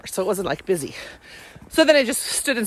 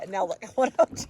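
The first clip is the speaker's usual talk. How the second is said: usual speaking voice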